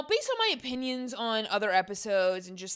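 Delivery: ordinary talk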